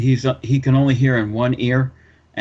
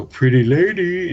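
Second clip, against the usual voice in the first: high, drawn-out mumbled call; boss imitation; 6 semitones higher, 4 dB louder, slower than usual. Prank-calling the secretary as the boss, slow mumbled impersonation. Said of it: "do a pretty good imitation of him".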